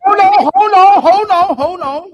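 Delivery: high, loud repeated cut-in; urgent interruption. Real, not acted. Debater cutting in on opponents talking over him, urgent. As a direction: shout over them to get the floor.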